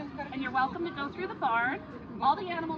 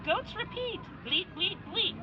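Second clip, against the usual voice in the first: put-on reading to a toddler; 6 semitones higher, slower than usual. A narrator's reading.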